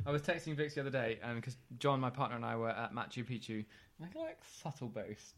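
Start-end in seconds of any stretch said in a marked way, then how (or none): 4.0-5.4 soft, slow aside; understated, tongue-in-cheek murmur; muted aside. Acted. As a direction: drop your voice and draw it out. Wry aside about own boast.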